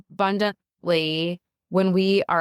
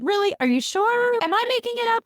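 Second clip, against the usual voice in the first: incredulous squeak. Retold or imitated. Imagined doubting quote to God.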